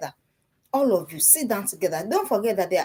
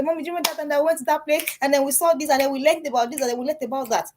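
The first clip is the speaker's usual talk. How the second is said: higher-pitched, imitated child's recollection; lightly voiced daughter; 6 semitones higher, faster than usual. Daughter reminding mommy about the trip, lightly imitated child voice.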